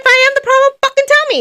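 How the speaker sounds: very high, loud outburst; exasperated shout. Acted. Co-host demanding friends tell her if she's the problem, shouted. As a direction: yell it out in high-pitched exasperation.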